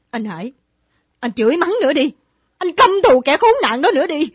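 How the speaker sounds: rising to an angry shout; bitter outburst. Acted. Woman lashing out at Hải, angry shout.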